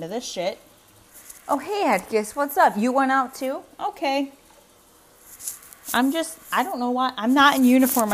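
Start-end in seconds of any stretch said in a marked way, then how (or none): 1.5-8.1 higher, much louder bright greeting to a pet; talking to the cat. Real, not acted. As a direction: sing out a hello to the cat walking in. Greets cat Atticus coming in.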